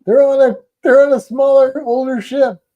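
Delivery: high, loud mocking quote of the cruise line; high mocking voice, "liquor 'em up". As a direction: ham up the company's cheap logic. Mocking cruise line's reasoning.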